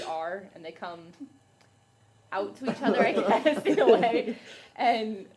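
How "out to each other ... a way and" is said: words breaking into a loud laugh; giggling, getting louder. Real, not acted. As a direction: laugh through the end of it. Coming out bit gets laughs.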